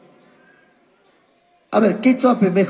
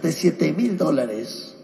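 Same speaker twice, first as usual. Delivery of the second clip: "de siete mil dólares" repeated softer and slower; softer echo; 4 semitones lower, 6 dB quieter, slower than usual. Acted. Pastor repeating the small starting sum to the church, softer and slower. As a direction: let the repeat sink in quietly. Repeats the amount, then drops.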